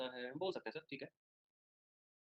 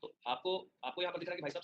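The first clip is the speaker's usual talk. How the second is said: usual speaking voice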